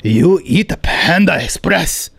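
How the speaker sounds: put-on slow Korean accent, accusing question; a light Korean accent, suspicious; Korean landlord accent. Landlord holds up takeout and questions tenant, Korean accent. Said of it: "my Korean landlord".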